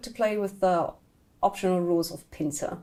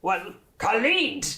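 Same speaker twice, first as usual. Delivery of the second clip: slow, swooping hero voice; Minsk; 2 semitones higher than usual. Acted. Game master as warrior sending Khalid first, slow character voice. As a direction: play the character with big, drawn-out pitch swings. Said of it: "Minsk is".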